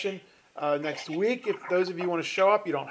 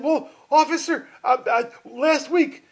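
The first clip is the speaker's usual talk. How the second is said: nervous driver; 8 semitones higher, 6 dB louder than usual. Acted. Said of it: "And the guy said".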